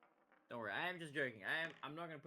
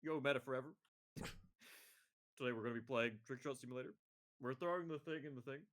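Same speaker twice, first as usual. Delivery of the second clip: put-on deeper streamer voice; forced deep voice. Shown as a demonstration. Streamer testing a deeper voice on viewers, forced deep. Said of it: "if I just started talking like this".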